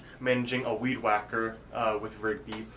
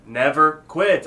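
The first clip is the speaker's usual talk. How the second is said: louder, drawn-out retort; insistent reply; 4 semitones higher, 8 dB louder, slower than usual. Retold or imitated. Rigby answering Muscle Man's challenge, insistent raised voice. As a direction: push it out slowly and loud.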